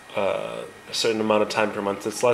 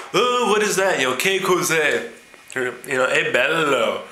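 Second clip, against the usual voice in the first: a hint of a gushing American tourist, higher and louder; lightly voiced tourist gawking at Florence; 5 semitones higher, 3 dB louder than usual. Tourist gushes to the tour guide, lightly mocking American voice. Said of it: "the Americans go".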